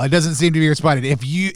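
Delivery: ordinary talk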